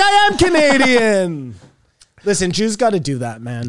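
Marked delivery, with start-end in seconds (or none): 0.0-1.5 a higher, louder proud claim; a lightly voiced patriotic boast; proud declaration. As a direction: announce it like a slogan. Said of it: "I'm like".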